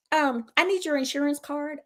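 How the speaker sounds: high mocking voice of the desk girl; mock receptionist. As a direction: mimic her indifference. Mimicking the indifferent receptionist.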